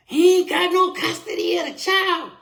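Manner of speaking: high, loud mock grandmother shouting; shrill grandmother yell. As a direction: play the meddling grandmother, yelling it high. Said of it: "who pull up? But Lula Mae Rivers".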